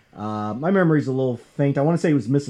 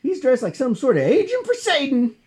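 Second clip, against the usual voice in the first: whiny thugs; 12 semitones higher than usual. Retold or imitated. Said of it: "they say".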